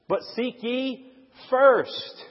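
"first" drawn out slowly on a high peak; stress on first. Shown as a demonstration. Preacher ranking God before all else, stressing "first". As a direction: set "first" apart and raise it. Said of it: "I'm emphasizing a different word".

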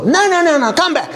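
high, insistent call back; urgent protest. Retold or imitated. Host stopping a man leaving with two tomatoes, urgent protest. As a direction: stop him with a high-pitched protest. Calling back a departing guest.

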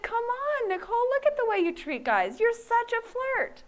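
mocking friend. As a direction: tease her like the guy friend. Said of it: "He said".

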